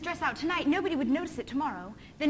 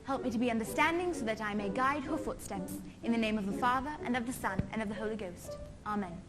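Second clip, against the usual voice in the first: hushed, low bedtime prayer; quiet prayer; 4 semitones lower, 5 dB quieter than usual. Acted. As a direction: pray softly under the breath. Prayer resumes after the interruption.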